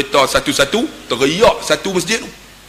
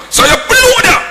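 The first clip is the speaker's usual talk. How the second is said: very high, loud shout; impassioned high cry; overwhelmed outcry; 6 dB louder than usual. Retold or imitated. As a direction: shout it as the overcome son. Son retells embracing his father.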